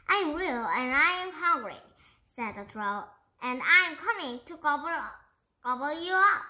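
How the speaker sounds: a hint of a menacing monster; threatening troll. Troll threatening to gobble Bertie, loud and fast.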